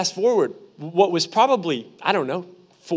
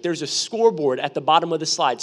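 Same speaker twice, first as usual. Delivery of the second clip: usual speaking voice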